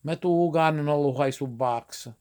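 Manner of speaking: slow Italian-American dialect; Italian-accented "ice box" with added vowels; drawn-out dialect demonstration; Italglish. Shown as a demonstration. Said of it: "So they say".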